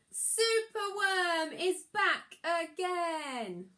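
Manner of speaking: joyful big reveal; triumphant announcement. A narrator's reading. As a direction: announce the hero's return with a flourish. Hero's triumphant return.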